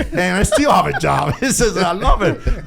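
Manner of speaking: words spilling out through real laughter; laughing. Real, not acted. To the hosts about his bad English, laughing.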